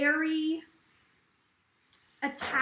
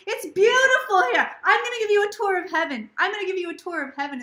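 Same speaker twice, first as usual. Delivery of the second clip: raised, loud voice of a delighted spirit; excited retelling; 6 semitones higher, 9 dB louder, faster than usual. Retold or imitated. Spirit shows the psychic around heaven, excited. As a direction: gush it out like an eager tour guide.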